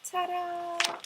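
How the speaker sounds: bright high flourish; cheerful ta-da. Acted. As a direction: present it with a flourish. Reveal flourish.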